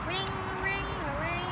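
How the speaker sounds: ordinary talk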